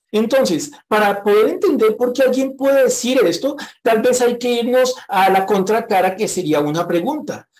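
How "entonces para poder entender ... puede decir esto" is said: ordinary delivery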